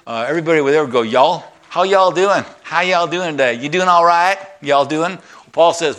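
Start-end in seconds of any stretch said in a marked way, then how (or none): 1.0-5.2 lightly put-on Southern accent, higher and sing-song; Tennessee greeting. Pastor imitates Tennessee locals greeting him, mild Southern accent. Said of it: "We actually went to Tennessee".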